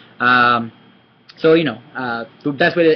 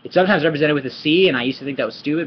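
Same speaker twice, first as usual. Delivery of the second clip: ordinary talk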